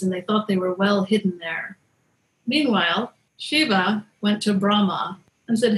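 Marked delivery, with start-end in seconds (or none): none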